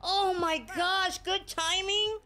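high, slow, drawn-out amazement; amazed. Real, not acted. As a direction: stretch it out in awe. Amazed at a catch.

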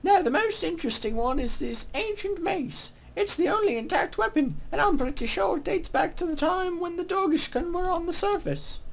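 high-pitched mock British woman; female-voiced British accent. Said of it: "my horrible female voiced British accent".

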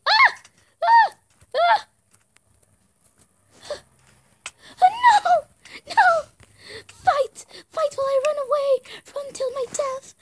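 high, loud screaming; panicked screams. Real, not acted. Gamer screaming as she runs from the fight. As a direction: scream and flee.

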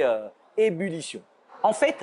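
ordinary talk

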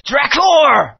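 loud, high call of Drakor. Acted. Hero confronting the villain Drakor, loud defiant call.

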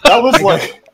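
sputter, lost for words; adrenaline rush. Real, not acted. Speechless after chaotic finish.

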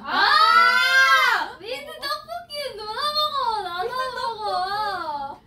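loud high shriek of disbelief; shocked shout. Real, not acted. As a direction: cry out in horror. Reacting to mint tteokbokki.

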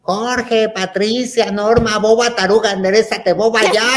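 calling out loud and high from afar; aunt shouting from a distance; aunt yelling. Retold or imitated. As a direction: yell the names like an annoyed aunt. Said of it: "oigo que grita allá".

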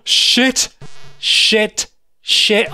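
sudden panicky burst, word after word; startled swearing. Real, not acted. Player caught out while looking down, startled swearing.

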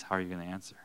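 ordinary talk

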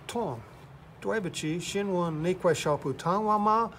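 put-on beginner's Mandarin in a Texas accent; Texan-accented Mandarin. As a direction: recite learner Mandarin with a heavy Texan accent. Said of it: "you got the worst accent I've ever heard".

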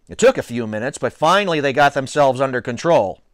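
ordinary delivery, nothing marked

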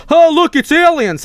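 put-on high, loud excited cry of a kid; excited kid shout. Kid shouting about aliens.

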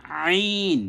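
high, stretched আইন squeezed from the throat; long Arabic ayn in আইন; mid-throat ayn. Shown as a demonstration. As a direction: open আইন with a tight pharyngeal sound. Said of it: "কণ্ঠনালীর মাঝখান থেকে উচ্চারণ হবে".

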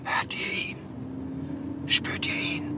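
hushed secretive whisper; whispering while hiding. Pretend. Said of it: "müssen ganz leise sein".

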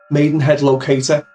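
ordinary delivery

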